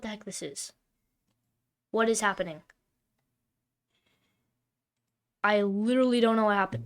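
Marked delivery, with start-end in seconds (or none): 1.9-6.8 loud frustration. Real, not acted. Frustrated at game.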